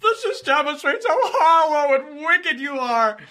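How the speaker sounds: high, loud, sobbing imitation; mock crying. Mocking the rival's tearful line again, sobbing and loud. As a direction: whine it out like he's about to cry. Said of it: "He's literally on the verge of tears".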